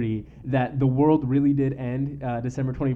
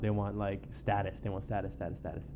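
fading to quiet; 6 semitones lower, 11 dB quieter than usual. Real, not acted. Repeating word, fading away.

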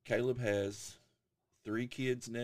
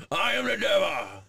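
put-on loud, high old devil growl; old man devil voice; 11 semitones higher, 10 dB louder than usual. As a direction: play an ancient devil, booming. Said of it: "That's my old man devil voice".

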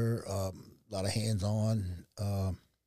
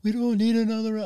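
high, loud upset wife; mock panicked wife voice, much higher and louder; 11 semitones higher, 7 dB louder than usual. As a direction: cut in high and loud, imitating his wife's alarm. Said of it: "She was like".

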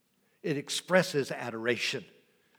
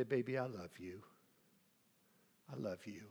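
tender to wife; 4 semitones lower, 11 dB quieter than usual. Retold or imitated. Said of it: "I say".

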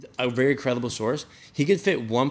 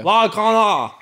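high, loud Aussie exclamation; hammed-up Australian accent, calling out; mock Australian; 8 semitones higher, 6 dB louder, slower than usual. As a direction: tease a mate in exaggerated Aussie. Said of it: "we were just talking Australian".

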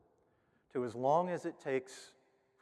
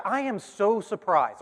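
higher and slowed, lingering on each word; amazed teacher; 6 semitones higher, 5 dB louder, slower than usual. Retold or imitated. Teacher telling the parents, slow and amazed. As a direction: stretch out the amazement.

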